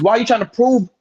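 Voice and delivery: ordinary talk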